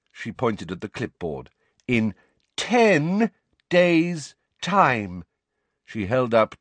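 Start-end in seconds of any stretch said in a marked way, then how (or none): 1.9-5.2 drawn-out, louder and higher; loud, slow voice. A narrator's reading. Umbridge talking down to Hagrid, loud and slow. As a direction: speak slowly as if to a child. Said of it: "that loud, slow voice".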